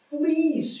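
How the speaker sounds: high startled cry of Fleas!; a sudden yelp at the bite; cried out. Acted. Corrie crying out to Betsy, startled. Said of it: "she cried".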